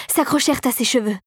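usual speaking voice

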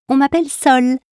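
high-pitched little musical note; high-voiced note. A narrator's reading. The note Sol introduces herself to Jeanne, high voice. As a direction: introduce yourself in a high, lifted voice. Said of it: "une autre voix moins aiguë".

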